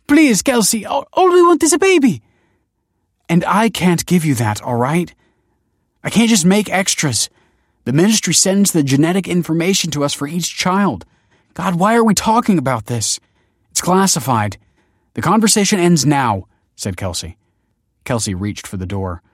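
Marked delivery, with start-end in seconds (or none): 0.1-2.2 very high, loud plea; desperate begging. A narrator's reading. Nina begging Kelsey for a baby, desperate. 3.3-16.5 raised, louder, agitated rebuke; firm refusal. A narrator's reading. Kelsey ending a forbidden conversation, agitated and firm. Kelsey angrily shuts down the plea.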